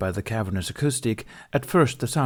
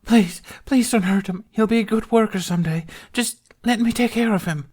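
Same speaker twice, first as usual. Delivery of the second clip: much higher, louder, desperate plea; pleading girl; 14 semitones higher, 5 dB louder than usual. A narrator's reading. Slave girl pleading with the Hutt for her baby, desperate. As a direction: beg for the child.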